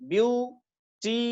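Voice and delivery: "Beautiful" slowly split into view, टी, फुल; three separate beats on "beautiful", pausing between; syllable by syllable. Shown as a demonstration. Teacher showing three syllables in "beautiful", slowly separated. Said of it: "तीन syllable है".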